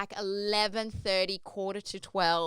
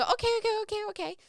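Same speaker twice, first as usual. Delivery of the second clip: high, rapid-fire chirping agreement; imitated waiter; 13 semitones higher, much faster than usual. Restaurant staff answering puzzled tourists, imitated high and hurried.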